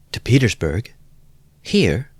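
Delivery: sudden alarm at the news. Acted. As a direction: she can't believe what she's hearing. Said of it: "Dunya asked in alarm".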